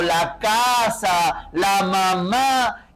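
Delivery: ordinary delivery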